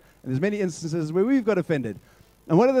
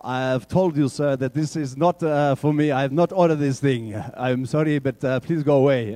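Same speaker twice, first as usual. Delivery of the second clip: put-on Mandela accent; 4 semitones lower than usual. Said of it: "Mandela refuses again".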